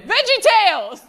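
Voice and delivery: high, sudden panicked answer; panicked blurt. Retold or imitated. An audience member put on the spot, panicked high blurt. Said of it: "panicked and went".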